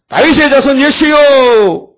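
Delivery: high, loud, drawn-out cry to Jesus; a mother crying out for her daughter; desperate cry. Acted. Canaanite mother crying out to Jesus for her sick daughter, loud and drawn out. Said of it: "막 소리 지르죠?".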